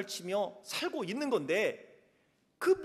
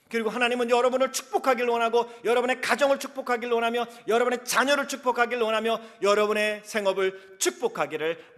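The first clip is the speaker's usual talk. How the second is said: 열정적 설교; 4 semitones higher, 8 dB louder than usual. Acted. 힘차게 설교하며 축복을 선포.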